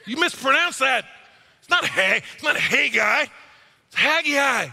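high, loud nitpicking correction; correcting wife. Retold or imitated. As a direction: play the pedantic wife scolding him. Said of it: "Don't say it".

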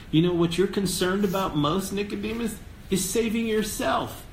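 a lightly voiced Jesus pressing the point home; Jesus confronting Nicodemus. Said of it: "He says".

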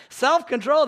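high questioning prompt; hopeful quiz question. Retold or imitated. Dad asking his kids about kindness, hopefully high. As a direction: pitch it up, asking for a grade.

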